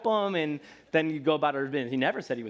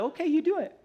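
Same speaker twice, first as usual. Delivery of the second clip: high, light letting-go voice; God voiced lightly, stepping back; mock resigned God; 12 semitones higher than usual. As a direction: shrug and let him try. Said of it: "And then God's like".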